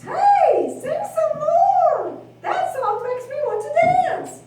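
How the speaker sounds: high, loud, eager call; excited pig. Acted. Second pig begs brother to keep singing.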